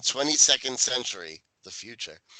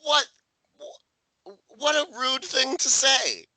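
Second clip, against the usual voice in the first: outraged; 13 semitones higher, slower than usual. Real, not acted. Outraged at chef.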